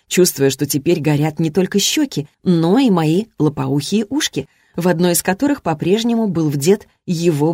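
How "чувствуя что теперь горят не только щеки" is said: usual speaking voice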